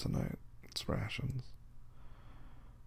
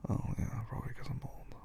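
whispering; 3 semitones higher, 6 dB quieter than usual. Real, not acted. Joking about being old.

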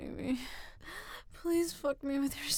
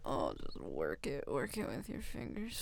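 ordinary talk, nothing marked

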